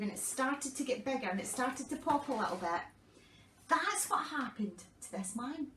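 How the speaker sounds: usual speaking voice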